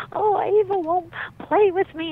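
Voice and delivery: put-on high, loud little-girl wail; mock a small child's tearful complaint; imitated child crying. Little daughter runs crying to her dad, wailing complaint. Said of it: "she's crying".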